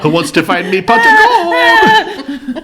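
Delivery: high, slow laughing Irish leprechaun. Pretend. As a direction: squeak it out like a giggling leprechaun. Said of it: "I'm the leprechaun".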